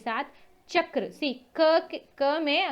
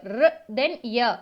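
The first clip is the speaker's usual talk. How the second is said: very slow spelling out; 4 dB louder, much slower than usual. Shown as a demonstration. Teacher splitting Surya for students, very slowly. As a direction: stretch र then य far apart, very slowly. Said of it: "Surya".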